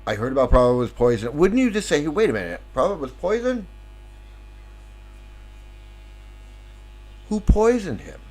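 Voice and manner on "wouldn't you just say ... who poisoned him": acted disbelief, pitch leaping up; incredulous questioning. Follower hearing Prabhupada was poisoned, incredulous. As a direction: pull up short and question it in mock astonishment.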